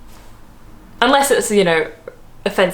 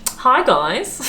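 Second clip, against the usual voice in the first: higher, swooping Australian greeting; mock Australian accent; 5 semitones higher than usual. Shown as a demonstration. Vlogger parodying own video intro, exaggerated Australian accent. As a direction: send up your own Aussie accent, rising at the end. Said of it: "mocking accents? But it's my own accent".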